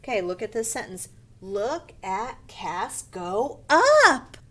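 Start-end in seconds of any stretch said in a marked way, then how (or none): 1.4-4.4 slow exclamation, UP punched loud; excited. Shown as a demonstration. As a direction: build to a big excited UP. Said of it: "We say excited".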